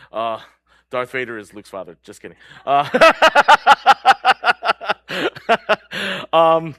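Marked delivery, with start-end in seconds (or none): 2.9-6.3 loud, high burst of laughter; real laugh, pealing out and tapering. Real, not acted. Preacher amused at own spoiler joke, loud real laugh.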